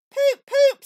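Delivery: very high, loud honk; imitating a horn. A narrator's reading. Toad mimics the car horn, high and loud with delight. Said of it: "said Toad".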